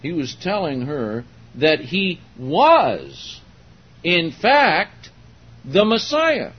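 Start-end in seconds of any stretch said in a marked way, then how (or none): 1.6-6.5 "was", "in fact", "the Messiah" set apart, loud and high; emphatic preaching. Real, not acted. Preacher reveals what Jesus told her, emphatic. Preacher drives home the climax.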